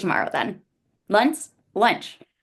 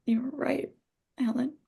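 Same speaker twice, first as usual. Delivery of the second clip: hushed whisper; 4 semitones higher, 7 dB quieter than usual. Acted. Cora breaks silence after sad scene.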